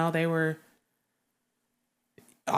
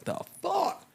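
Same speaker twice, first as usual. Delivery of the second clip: much higher, incredulous; baffled; 13 semitones higher than usual. Retold or imitated. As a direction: spit it out in disbelief. Sailors reacting to alarm orders.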